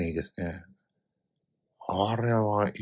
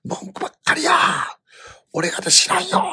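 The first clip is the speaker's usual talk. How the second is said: very high, loud exasperated yell; furious shout; 10 semitones higher, 5 dB louder than usual. Retold or imitated. Father losing it at his son in Tokyo Station, furious shout. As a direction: explode at him at the top of your voice. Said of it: "もうものすごい声で".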